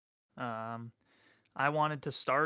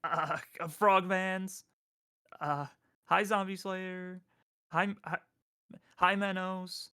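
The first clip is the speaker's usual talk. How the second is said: higher, bouncy cartoon hellos; chirpy high hellos to each chatter; SpongeBob-style greetings; 6 semitones higher than usual. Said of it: "I'm gonna do the SpongeBob thing".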